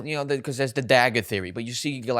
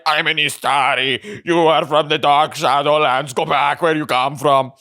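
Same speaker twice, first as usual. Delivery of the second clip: put-on slow, heavy, booming wizard with an action-hero accent; Arnold Gandalf voice; 5 dB louder than usual. Imitating Gandalf telling Sauron to go back where he came from, in a booming action-hero voice. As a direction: lay down the law like a muscle-bound wizard. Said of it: "I don't know why he sounded like that".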